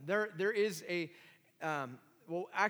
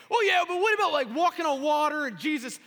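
higher, loud mocking objection; voicing a skeptic; 11 semitones higher, 13 dB louder than usual. Preacher voicing a skeptical listener, mock objection. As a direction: play the doubter in the pews. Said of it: "Some of you are thinking".

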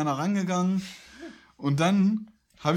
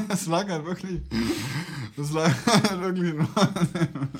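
laughing through the words; giddy, can't stop giggling; overexcited giggling. Real, not acted. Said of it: "Warum lachst du denn jetzt so?".

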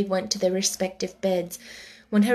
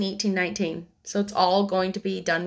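ordinary delivery

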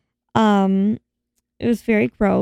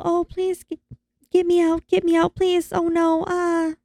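high, pleading damsel voice; hammed-up damsel pleading to be rescued; mock damsel in distress; 8 semitones higher than usual. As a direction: play the helpless damsel, begging. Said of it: "this whole damsel in distress thing".

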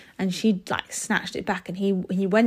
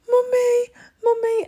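put-on very high, louder little-boy cry of "Mummy"; pleading toddler; 4 dB louder than usual. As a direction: imitate a small child calling for mum. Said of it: "And he was like".

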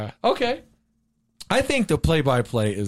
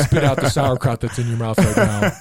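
mock magician's command, louder; lightly put-on illusionist giving orders; Chris Angel; 3 semitones lower, 5 dB louder than usual. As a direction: order it as a stage magician, amused. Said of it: "your Chris Angel impersonation".

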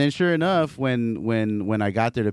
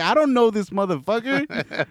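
higher mock outrage; retold disbelief; 8 semitones higher than usual. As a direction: protest it in a raised voice. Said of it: "I was like".

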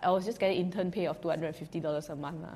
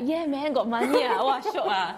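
excited; 5 semitones higher, 4 dB louder than usual. Retold or imitated. Excited about getting money.

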